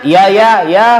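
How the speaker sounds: curt, loud, grudging yes yes yes; annoyed child cutting the mother short; impatient yes. Acted. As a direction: brush the mother off with a sharp yes. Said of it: "intonasi yang tidak mengenakan".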